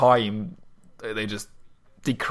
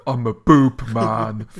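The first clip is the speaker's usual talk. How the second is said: proud boast; 6 dB louder, slower than usual. Retold or imitated. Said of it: "He's like".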